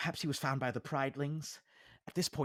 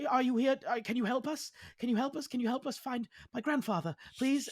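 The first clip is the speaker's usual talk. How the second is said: put-on high, pleading young voice; high anxious plea for help; pleading stranger; 14 semitones higher, 5 dB louder, faster than usual. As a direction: beg for help in a raised voice.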